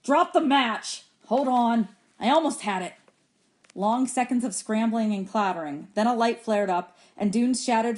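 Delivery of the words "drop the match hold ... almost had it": slightly raised, frustrated cry; angry yell. A narrator's reading. Boy who slid and lost his match, mildly yelling in rage. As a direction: exclaim in understated rage. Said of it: "He gave a yell of rage".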